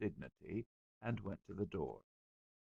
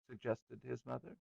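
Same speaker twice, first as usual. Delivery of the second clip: ordinary delivery, nothing marked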